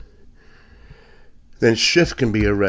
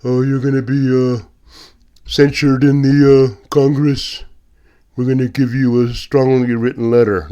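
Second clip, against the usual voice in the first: mocking prim voice; 3 semitones higher, 5 dB louder than usual. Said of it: "I'm not talking about".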